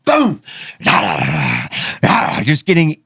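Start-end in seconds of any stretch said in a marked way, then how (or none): 0.0-0.4 high sharp boom; imitating dad hitting the dog, high sharp boom; shovel impact. 0.6-2.4 low drawn-out growl; voicing the pit bull shrugging off the hits, low growl; mock dog growl.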